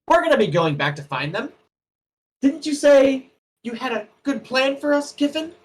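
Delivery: high, sharp scolding shout; angry snap. Acted. Gnome scolds the sobbing woman, sharp and loud. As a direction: slap sense into her with your voice. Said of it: "smacks her in the face twice and says".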